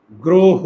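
গ্রহ as "gro-ho", both vowels closed o; pronunciation demo. Shown as a demonstration. Teacher gives the pronunciation of the word, emphatic rounded গ্রহ. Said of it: "এটার উচ্চারণ হচ্ছে".

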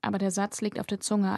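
usual speaking voice

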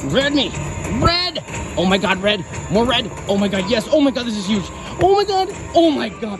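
high, rising excited shrieks; shrieking jackpot winner. Real, not acted. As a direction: shriek as the wins pile up. Cheering big slot win.

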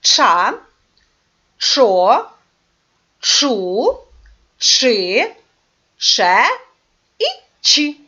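Ча, чо, чу, чи, че, чі each set apart and stressed; each syllable said alone and clearly, with a pause after it; syllables repeated. Shown as a demonstration. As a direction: lead the class through the syllables. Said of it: "Повторіть, будь ласка".

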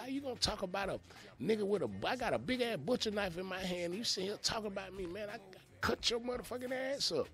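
high, heated threat with a knife in hand; retold threat. Telling the old men off while holding a butcher knife, heated. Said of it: "I said".

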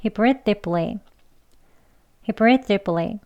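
quick, run-together model sentence. Shown as a demonstration. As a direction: say it briskly in one go. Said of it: "빨리 두 번 해보겠습니다". (빠르게 이어서)

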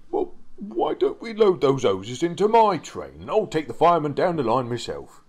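volunteer keenly, then hedge; eager engine Richard. Acted. Richard's figure offers help.

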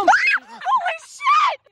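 sudden high terrified shriek; startled scream. Real, not acted. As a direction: scream in real shock. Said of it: "He just scared the shit out of me".

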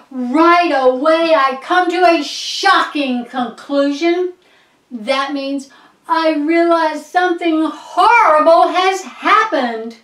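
loud, high, urgent telling; alarmed realization. Acted. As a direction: tell it as a dawning disaster. Narrator reacts to horrible realization.

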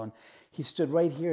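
ordinary delivery

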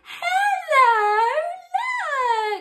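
high delighted hello; high, excited greeting to a toy duck. Acted.

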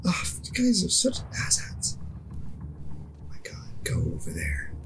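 hushed unvoiced whisper. Real, not acted. Gamer hiding from players, whispering curses. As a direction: whisper so nobody hears.

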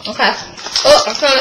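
chewing while talking; talking between bites; mouth partly full. Real, not acted. Seen 1: Cheeks puffed, chewing while reaching into bag; ring later blocks face.

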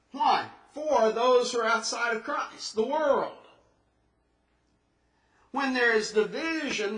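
full-force sermon delivery; preaching. Acted. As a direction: drive the point home to the congregation.

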